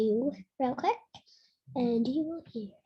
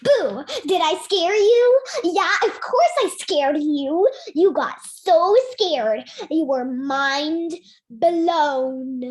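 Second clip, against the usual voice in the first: loud playful scare shout; startling boo; 4 semitones higher, 9 dB louder, slower than usual. Pretend. Jump scare on viewer.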